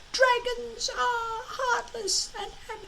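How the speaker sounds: high, slow, stuttering dragon voice; a small dragon arguing with itself, tripping on the words; squeaky stutter, talking himself into it; stammering little dragon. A narrator's reading. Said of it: "argued Toothless to himself".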